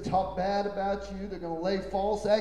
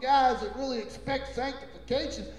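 high, loud scandalized warning; hammed-up busybody warning a friend off; imitating a gossiping neighbour; mock gossip; 8 semitones higher, 4 dB louder than usual. Imitates gossip about the church.

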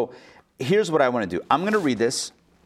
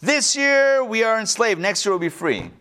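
making a declaration; 5 semitones higher than usual. Acted. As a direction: declare it formally, drawing out the words. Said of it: "We make a declaration".

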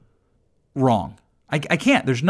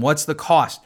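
ordinary delivery

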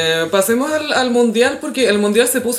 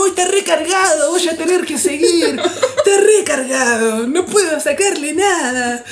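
witch voice; 6 semitones higher, slower than usual. Retold or imitated.

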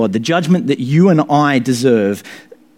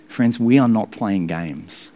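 ordinary delivery

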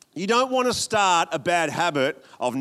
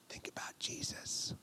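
soft breathy whisper; 19 dB quieter than usual. Acted. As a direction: hush it like a secret. Whispered aside in sermon.

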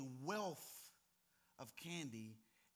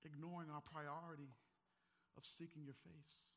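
soft prayer; 5 dB quieter than usual. Acted. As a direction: pray gently after the storm. Closing prayer, soft and low.

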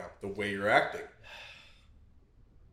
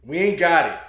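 loud, raised mock announcement; hammed-up holler; 5 semitones higher, 11 dB louder than usual. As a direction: belt out the bad news playfully. Hammed-up announcement of having no topic.

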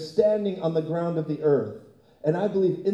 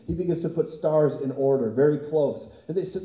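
ordinary talk

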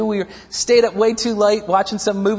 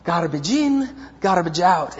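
put-on mother's Italian accent; 4 semitones lower than usual. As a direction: echo mom's phrase in her Italian lilt. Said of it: "with that Italian accent".

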